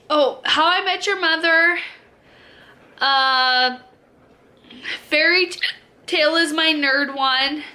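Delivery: usual speaking voice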